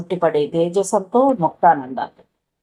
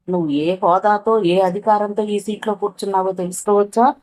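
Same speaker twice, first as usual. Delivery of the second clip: usual speaking voice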